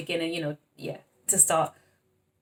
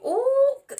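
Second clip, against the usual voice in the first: squealing laugh; 7 dB louder than usual. Real, not acted. Overwhelmed by the reading.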